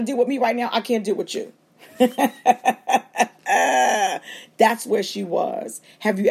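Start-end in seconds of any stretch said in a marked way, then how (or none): 2.0-4.3 short laugh. Real, not acted. Preacher laughs after the quote.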